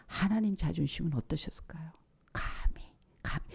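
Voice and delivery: hushed sermon whisper; dramatic whisper. Real, not acted. Preacher whispering to the congregation about God's wounded pride. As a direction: drop to a whisper to draw them in.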